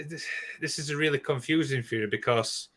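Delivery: usual speaking voice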